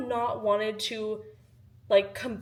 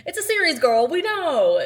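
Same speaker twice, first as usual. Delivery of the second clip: sassy; 7 semitones higher, 8 dB louder than usual. Acted. Sassy aside to viewers.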